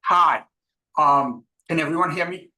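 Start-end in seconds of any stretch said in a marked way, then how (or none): none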